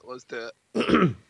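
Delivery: stretched slow hype; slow, drawn out. Shown as a demonstration. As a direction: drag the words out slowly.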